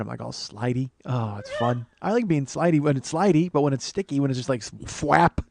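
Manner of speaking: ordinary talk, nothing marked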